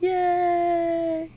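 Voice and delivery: high, happy little yay; excited cheer. Real, not acted. Proud of sister graduating.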